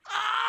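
long, high, loud scream; re-enacted scream. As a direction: re-enact screaming in pain at full voice. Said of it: "I screamed at the top of my lungs".

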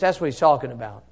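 ordinary delivery

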